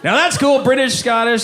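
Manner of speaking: ordinary talk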